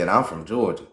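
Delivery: ordinary delivery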